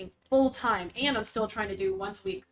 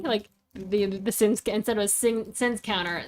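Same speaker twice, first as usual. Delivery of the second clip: ordinary delivery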